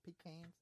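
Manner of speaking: "Pecans" as high PEE-CANS; other pronunciation of pecans. Shown as a demonstration. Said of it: "The pronunciation of pecan".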